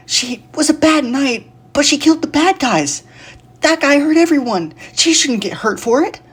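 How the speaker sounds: put-on high, loud child's voice; young boy shouting. A narrator's reading. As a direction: yell like an upset little boy. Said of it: "Alex screamed at the man".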